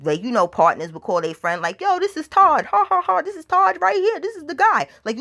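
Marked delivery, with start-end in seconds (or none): 1.8-4.9 high-pitched mocking glee; acted-out gleeful announcing, pitched way up; excited ridicule. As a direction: crow to a friend about a local celebrity. Officers excitedly showing off Todd.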